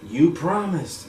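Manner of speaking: high, drawn-out reproach; mock tearful plea. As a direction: lightly imitate someone crying over a broken promise. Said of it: "crying and all that".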